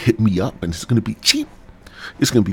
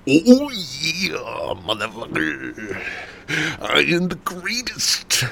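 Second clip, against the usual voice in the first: higher, slow hammed-up guru growl; Tony Robbins impression; 8 semitones higher, slower than usual. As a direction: ham up a larger-than-life guru. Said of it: "Be like".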